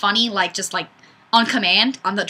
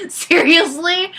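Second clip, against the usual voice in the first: incredulous; 6 semitones higher, slower than usual. Real, not acted. Reacting to a silly comment.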